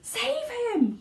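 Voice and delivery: very high panicked shriek; screaming mother. A narrator's reading. Mother screaming as her son sinks, very high. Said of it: "screamed Missus Gloop".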